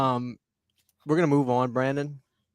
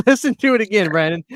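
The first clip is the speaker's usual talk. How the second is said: loud, high, through laughter; cracking up as you talk; barely holding back a big laugh; 6 semitones higher, 8 dB louder than usual. Real, not acted. Cracking up before replaying the clip.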